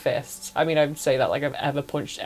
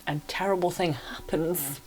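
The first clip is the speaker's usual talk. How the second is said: softer, hushed whisper for the shock; dramatic whisper; 6 dB quieter than usual. Real, not acted. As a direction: drop to a suspenseful whisper. Building suspense in retelling.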